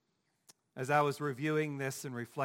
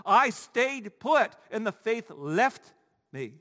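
raised, driving sermon delivery; emphatic preacher, building higher and louder; forceful preaching; 5 semitones higher, 7 dB louder than usual. Real, not acted. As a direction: preach it with mounting urgency.

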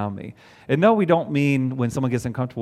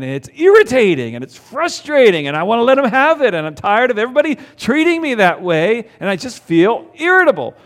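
frustrated outburst; 8 semitones higher, 7 dB louder than usual. Acted. Acting out irritation at grocery store.

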